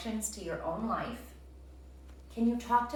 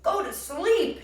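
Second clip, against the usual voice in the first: high, loud excited shout; 11 semitones higher, 7 dB louder than usual. A narrator's reading. Toad shouts at Frog, thrilled by the idea. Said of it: "shouted Toad".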